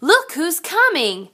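ordinary delivery

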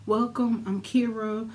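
usual speaking voice

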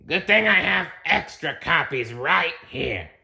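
strict monster manager voice; bossy monster. Acted. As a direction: announce it like a stern new boss. Floz character voice.

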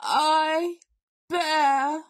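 grand invalid bear; solemn bear. A narrator's reading. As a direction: dictate a will with great ceremony. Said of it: "Bear gazed thoughtfully".

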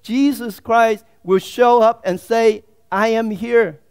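loud, fervent preaching. Acted. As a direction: preach it with full force. Preaching at full force.

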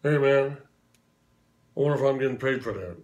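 lightly voiced Southern rock star, opening loud. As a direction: drop a cool, offhand aside like the King. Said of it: "as only he can do".

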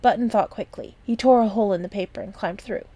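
plain reading voice

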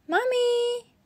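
high calling shout. A narrator's reading. Peppa calling Mommy downstairs, high shout. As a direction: call Mommy from afar. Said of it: "shouted Peppa".

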